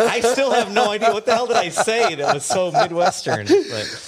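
high, laughing through words. Real, not acted. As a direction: laugh while talking. Embarrassed over accent slip.

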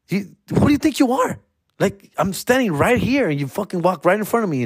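high, huffy scolding; hammed-up insulted cop; mock offended cop.

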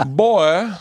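high, hammed-up Southern gentleman drawl; rich North Carolina Southerner. Rich older man complaining about a drink, North Carolina accent. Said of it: "because of his accent".

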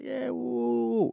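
high, giddy woo-woo of a happy stripper; a high playful woo; mock giddy woo. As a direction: squeal it off like nothing's wrong. Stripper happily brushing them off.

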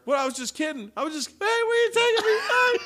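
very high, shouting, crying plea; panicked pleading. Pretend. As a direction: squeal like a man being dragged off. Acting out the arrested sailor.

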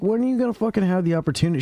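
high, rushed retold disbelief; mock incredulous. Brother questioning his sister's advice, high fast incredulity. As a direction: rattle it off high, as if baffled.

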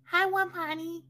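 high, careful "Hello, Wasani"; a small child greeting the baby brother perfectly; little kid's formal hello; mock polite toddler. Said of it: "you weren't like".

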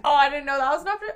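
fast, bright mock surprise; mock surprised. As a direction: gasp it out like it's news. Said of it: "I was like".

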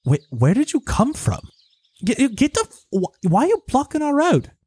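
put-on high, exasperated British-accented villager; British-sounding villager. Farmer trying to get to town, British-accented and exasperated. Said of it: "Your accent is, like, British".

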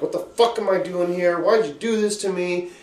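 high, loud panicked protest; anxious outburst. Retold or imitated. Retelling pacing in the facility, anxious outburst at wife. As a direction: act out an agitated, high-pitched demand. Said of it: "I'm fucking having anxiety".

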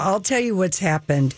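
ordinary delivery

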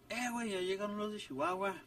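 high-pitched retold call across the room; imitated shout. Guy announcing the band's arrival to another, mock shout. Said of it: "y le grita".

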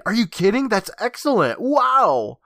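high-pitched burst of mock excitement; mock excited. Shown as a demonstration. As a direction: ham up David finally getting excited. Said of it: "This is the most excited we see him".

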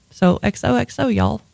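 playful Southern goodbye; Southern accent. Real, not acted. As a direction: sign off in a put-on Southern accent. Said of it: "I love your fake accent".

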